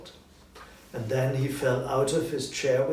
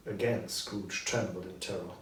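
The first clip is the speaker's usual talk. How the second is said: usual speaking voice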